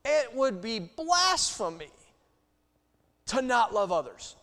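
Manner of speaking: loud, high, slow preaching with punch; full-force preaching. Acted. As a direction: preach it hard to the congregation. Preacher building to a peak.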